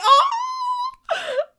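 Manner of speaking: aww. Retold or imitated. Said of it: "I was like".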